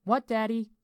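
put-on high, loud child voice; little girl. A narrator's reading. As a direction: call back to Dad as a small daughter. Narrator voices the small daughter.

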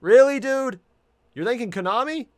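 high, loud incredulous questioning; real disbelief. Real, not acted. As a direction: challenge them in loud disbelief. Disbelief at who is being thanked.

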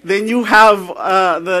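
normal talking voice

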